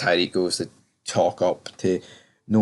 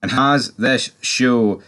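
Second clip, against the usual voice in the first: loud, slow, higher hype read; big booming channel intro; video intro announcer; 3 semitones higher, 6 dB louder, slower than usual. Acted. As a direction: announce the episode like a show opener.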